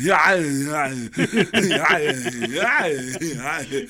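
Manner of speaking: mangled action-hero groans; Arnold Schwarzenegger. Acted. Comic excusing his voice as post-crash, garbled groaning impression. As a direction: moan out garbled, wounded yeahs. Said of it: "Arnold Schwarzenegger after a bad car accident".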